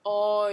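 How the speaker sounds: long, loud "O" opened at the back of the mouth; "O" held out with a diphthong glide; Australian O sound. Shown as a demonstration. Tutor showing the correct vowel, long open-backed Australian O.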